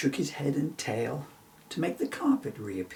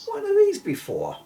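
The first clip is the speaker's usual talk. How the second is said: proud question; 9 dB louder than usual. Acted. Bill showing off his horn, high proud question. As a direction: voice leaps much higher and louder on the question. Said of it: "he asked with quite some pride".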